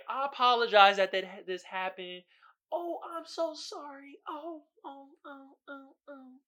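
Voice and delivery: high, slow, fading apologetic voice; customer service voice. Retold or imitated. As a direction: grovel sweetly, trailing away. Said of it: "You gotta be like".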